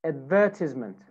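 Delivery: British "advertisement", stressed on "VER"; British accent. Shown as a demonstration. Teacher models British "advertisement" for students, stress on second syllable. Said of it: "British accent".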